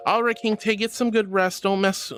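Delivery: ordinary delivery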